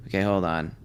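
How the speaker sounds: usual speaking voice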